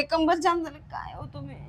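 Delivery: usual speaking voice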